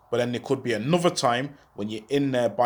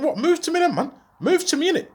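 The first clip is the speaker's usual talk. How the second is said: confrontational yell. Retold or imitated. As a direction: dare them to come at him, voice jumping up. Squaring up to three men.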